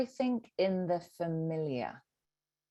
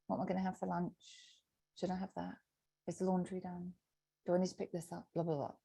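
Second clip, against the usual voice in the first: mundane inner voice; 11 dB quieter, faster than usual. Retold or imitated. Mundane narrating ego voice.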